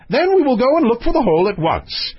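high-pitched royal command; impatient king. Acted. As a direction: give orders as an excitable king.

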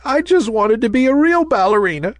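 high, plaintive little voice; tearful. A narrator's reading. Ballerina confessing her dream to her maker, tearful. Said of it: "she sniffed".

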